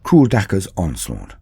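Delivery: usual speaking voice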